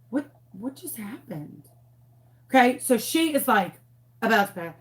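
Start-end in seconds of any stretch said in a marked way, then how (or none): 0.1-1.6 hushed, confused aside to herself; baffled half-whisper. Retold or imitated. Teacher wonders to herself mid-lesson, hushed and confused. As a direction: mutter it under your breath, lost. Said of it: "And I was like".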